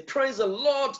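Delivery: high, bright "Praise the Lord". Shown as a demonstration. Preacher demonstrating a high, lifted praise. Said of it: "I can be high, I can say".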